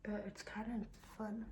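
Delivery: whispering. Real, not acted. Private aside.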